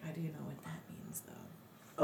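breathy whisper, almost silent; whispered aside. Retold or imitated. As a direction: whisper the truth to yourself. Private aside after he leaves.

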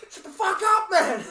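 loud, high roommate yell; mock angry shout at the narrator. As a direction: bark it like a woken, furious mate. Roommate yelling at him at night.

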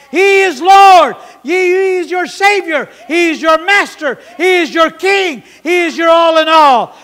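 loud, high declaration; proclaiming shout. Real, not acted. Preacher to the congregation, shouting high and loud. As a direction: declare it to the whole church.

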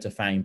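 Tofane said tuh-FAYN; alternative pronunciation. Shown as a demonstration. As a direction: rhyme the end of Tofane with feign. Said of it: "I've heard Tofane".

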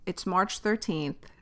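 ordinary talk

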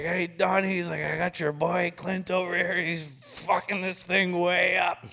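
high, loud mocking boss voice on the phone; imitating angry boss. Client on the phone ratting to Don, mock loud boss. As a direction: ham up the furious client.

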